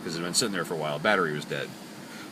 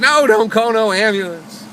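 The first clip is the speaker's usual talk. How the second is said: loud, high, drawn-out protest; caricature of homeless woman; 12 semitones higher, 8 dB louder, slower than usual. Retold or imitated. Driver imitating homeless lady refusing an ambulance, loud high caricature. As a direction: wail it in an exaggerated mimicking voice. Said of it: "I'm sorry for the horrible caricature".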